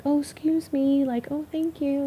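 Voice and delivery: high, sweet, slow polite voice; polite Texans. Retold or imitated. Telling friends how Texans at the store talk, sweet polite imitation. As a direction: imitate the overly nice locals lightly. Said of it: "they're just like".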